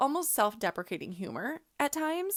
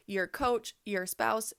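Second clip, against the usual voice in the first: ordinary delivery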